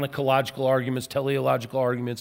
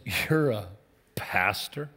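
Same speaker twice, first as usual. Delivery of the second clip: low, drawn-out disbelieving "pastor?"; the professor scoffing at the young pastor, incredulous; incredulous question; 8 semitones lower, 7 dB quieter, slower than usual. Retold or imitated. Sneering at a pastor in a mall booth, doubtful. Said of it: "Just like that".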